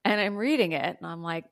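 usual speaking voice

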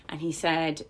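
ordinary delivery

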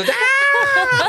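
very high cartoon scream. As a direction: shriek like a pulled-up root. Pulled-up root screaming.

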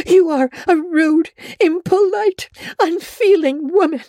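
high, choked, trembling rage; tearful fury. A narrator's reading. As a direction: spit out her hurt through tears. Anne's furious outburst at Rachel.

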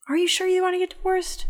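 very high, incredulous questioning; doubtful repeated question. Retold or imitated. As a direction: press the question in a high, disbelieving voice. Judge asks again, doubting him.

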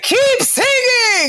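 high, slow drawn-out yell; shouting encouragement. Retold or imitated. Friend Serena cheering her on from the stairs, yelling high and slow. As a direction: holler it from the top of the stairs. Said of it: "She's like".

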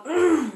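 forced throat clear; a deliberately bad fake cough. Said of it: "that was a really bad fake cough".

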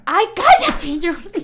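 loud, high mock outrage. Real, not acted. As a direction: tell the friend to shut up, joking. Teased by friend. (indignación fingida contra Jordi)

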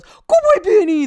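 very high, loud falsetto praise; hammed-up hooting baby talk; dog dad imitation. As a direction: mimic a doting dog dad whooping. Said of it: "Brett just goes".

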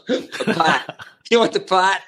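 amused Boston-accent imitation, "paht" for part; Massachusetts accent. Comic replaying the Massachusetts producer's offer, imitated Boston accent. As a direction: mimic Boston's dropped r through a laugh. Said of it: "a producer from Massachusetts who goes".

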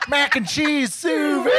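loud kitchen call of "sous vide"; shouted order. Retold or imitated. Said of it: "whenever someone orders".

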